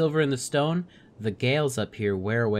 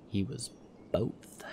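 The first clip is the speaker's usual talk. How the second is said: hushed unvoiced secret; whispered; 2 semitones lower, 9 dB quieter, slower than usual. Companion Juno revealing a secret, whispered. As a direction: breathe the word like a secret. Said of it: "narrator GM voicing Juno secretively".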